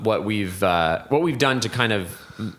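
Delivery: ordinary delivery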